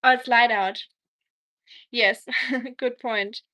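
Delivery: ordinary talk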